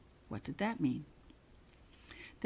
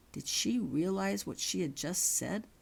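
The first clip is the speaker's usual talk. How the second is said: ordinary delivery, nothing marked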